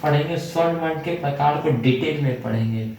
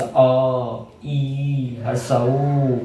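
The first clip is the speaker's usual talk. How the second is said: slow, with the vowel अ drawn out long; drawn-out vowel demo; 3 semitones lower, much slower than usual. Shown as a demonstration. Teacher showing students how to voice the vowel अ, slowly drawn out. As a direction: stretch the sound अ so the class hears it. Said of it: "जब आप बोलिएगा तो आपको पता चलेगा".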